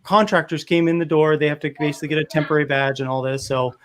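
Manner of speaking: normal talking voice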